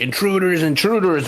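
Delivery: high-pitched mock alarm call; imagined giant's alarm. Player guessing what the giant is yelling, mock alarm. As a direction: cry it out high, like a sentry warning. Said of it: "is it saying, like".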